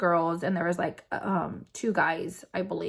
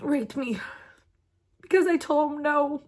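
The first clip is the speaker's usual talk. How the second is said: higher, choked-up voice with long pauses; fighting back real tears to get each phrase out; tearful; 5 semitones higher than usual. Real, not acted. Survivor recounting her partner's assault, tearful.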